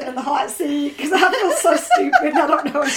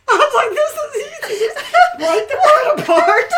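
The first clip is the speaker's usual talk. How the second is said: higher-pitched, breaking up with laughter; laughing; 11 semitones higher, 6 dB louder than usual. Real, not acted. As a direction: explain it through helpless giggles. Cracking up over the wrong answer.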